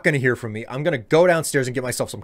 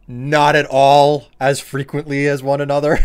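emphatic loud; 2 semitones higher, 9 dB louder than usual. Real, not acted. Emphatic point, laughs after.

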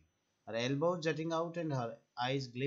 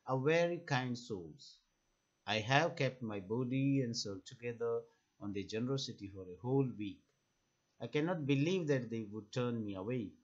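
ordinary talk, nothing marked

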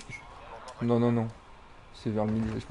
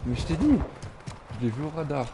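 ordinary talk

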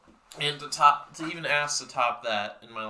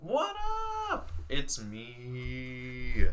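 showy greeting; much slower than usual. Real, not acted. Vlogger greets viewers, showy drawn-out greeting. Exaggerated hello opening the video.

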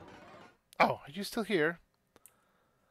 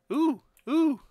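ghostly ooh; 9 semitones higher, 6 dB louder than usual. Acted. Playful ghostly oohs.

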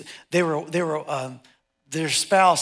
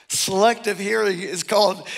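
ordinary talk, nothing marked

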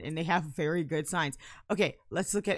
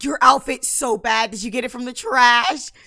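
put-on mean girl Lucy; 5 semitones higher, 12 dB louder than usual. Lucy mocking Anne Marie's outfit, catty and loud. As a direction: throw a loud catty insult. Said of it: "Lucy's a mean girl".

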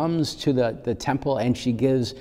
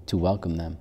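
usual speaking voice

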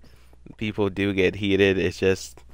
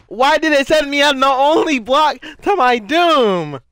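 high, loud outraged wail; long shrieked complaint; screaming; 5 dB louder than usual. Real, not acted. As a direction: howl at the unfair game. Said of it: "me screaming".

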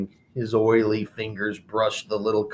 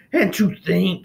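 hissed; 8 semitones higher, 4 dB louder than usual. Acted. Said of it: "he hissed".

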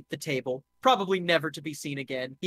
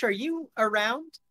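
normal talking voice

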